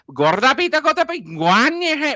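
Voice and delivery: loud, very high sing-song Hindi imitation; mock Hindi accent. Demonstrating why Hindi resembles Welsh, exaggerated high Hindi intonation. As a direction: hit each word hard in mock-Hindi intonation. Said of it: "Hindi, you know, they go".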